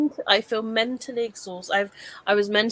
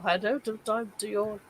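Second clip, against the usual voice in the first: usual speaking voice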